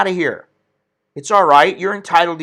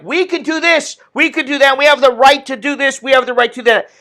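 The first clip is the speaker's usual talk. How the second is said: mocking protesters; 9 semitones higher than usual. Ranting at protesters, high mocking whine of their demands. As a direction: parody the snowflakes demanding rights.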